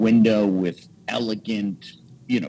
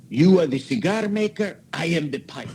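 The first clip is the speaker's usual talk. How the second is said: higher, proud Italian friend's reply, lightly voiced; a hint of a proud Italian craftsman, higher; voicing Massimo; 8 semitones higher than usual. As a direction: lift the voice for the old pipe maker's grand line.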